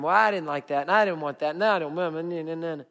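mock grumbling. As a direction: imitate a complainer running on into nonsense syllables. Mimicking short-term complainers, trailing into gibberish.

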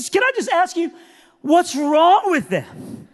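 high, drawn-out exasperated squeal; high-pitched mock distress; emotional cleaning lady. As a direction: imitate a baffled, overwrought lady, slowly. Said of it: "sorry to be emotional".

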